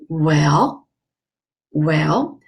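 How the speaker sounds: "well" dipping low then rising; acted doubtful, drawn "well"; thinking, hesitating. Patient weighing dental fillings, hesitating. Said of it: "you were thinking and hesitating".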